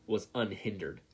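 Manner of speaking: ordinary delivery, nothing marked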